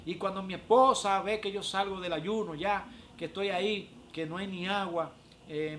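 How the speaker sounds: ordinary delivery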